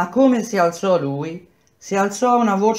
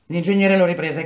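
usual speaking voice

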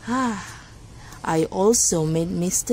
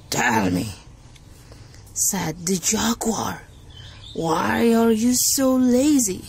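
story narration. Acted. Reads animal story to class.

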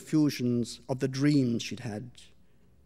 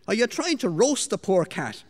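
scolding; 6 semitones higher, 4 dB louder than usual. Acted. Mistress scolds maid over kitten.

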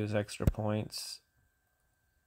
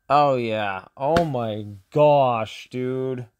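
loud, drawn-out despairing groan; exasperated groan; 3 semitones higher, 10 dB louder, much slower than usual. Real, not acted. Gamer groaning at his losing match, loud and drawn-out. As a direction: let it out, stretched and loud.

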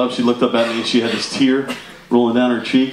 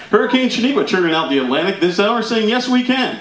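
raised, projected TV weather announcer voice; mock broadcast delivery, climbing higher; Weather Channel anchor; 7 semitones higher than usual. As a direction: announce it like breaking storm news. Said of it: "turn on the Weather Channel and it's like".

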